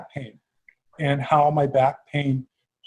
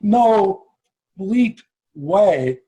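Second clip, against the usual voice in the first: real astonished outburst, louder and higher; freaking out; 5 semitones higher, 6 dB louder, slower than usual. Real, not acted. Host interrupts guest on hearing a familiar name, freaking out. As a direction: blurt it out in stunned amazement. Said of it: "freak out?".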